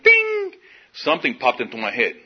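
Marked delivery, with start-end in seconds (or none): 0.0-0.5 very high, ringing chime imitation; elevator ding. Storyteller imitating the elevator at the bottom floor, high ding. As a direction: ring it out like a bell. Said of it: "that elevator went".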